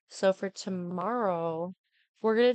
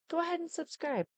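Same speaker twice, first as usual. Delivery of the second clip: high sing-song request; 10 semitones higher, 3 dB quieter than usual. Real, not acted. Asking viewers to subscribe.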